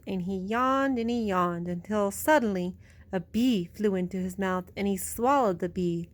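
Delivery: plain reading voice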